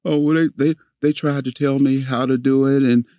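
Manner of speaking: put-on high, reluctant boy's voice; grudgingly owning up; sheepish kid. Boy admitting to grandma they tried to help, high.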